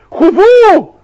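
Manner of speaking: hoch und laut gerufen: "خذوه" als endgültiges Urteil; lauter Befehl. Acted. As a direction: befiehl den Engeln, ihn zu packen. Befehl des Königs am Gericht.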